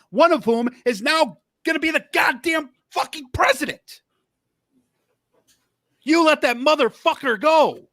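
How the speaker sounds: high, angry outburst; outraged yelling at a politician; furious rant. Real, not acted. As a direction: spit it out in disbelief.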